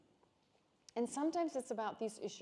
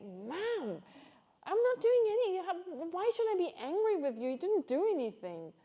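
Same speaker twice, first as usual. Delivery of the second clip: much higher and louder exasperated protest; exasperated reply; 9 semitones higher, 7 dB louder than usual. Retold or imitated. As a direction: protest loudly at the mom who keeps asking. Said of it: "And I'm like".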